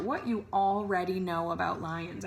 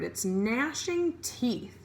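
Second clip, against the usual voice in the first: ordinary talk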